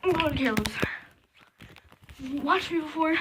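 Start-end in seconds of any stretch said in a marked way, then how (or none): 0.0-0.7 unvoiced whisper. Real, not acted. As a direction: whisper it like a secret. Whispered aside.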